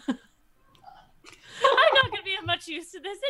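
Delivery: nervous laugh. Acted.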